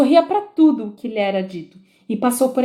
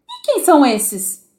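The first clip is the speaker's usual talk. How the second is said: queen inquiring; 5 semitones higher, 3 dB louder than usual. A narrator's reading. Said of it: "inquiriu a rainha".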